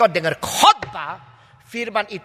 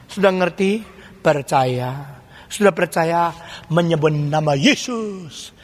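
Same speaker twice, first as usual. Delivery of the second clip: slower and lower, each step laid out; slow, deliberate repeat; 7 semitones lower, slower than usual. Shown as a demonstration. Preacher repeating the steps slowly for the congregation. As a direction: spell out every step slowly so they catch it. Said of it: "Dengar sekali lagi".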